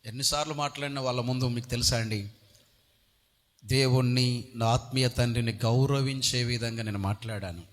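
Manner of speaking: normal talking voice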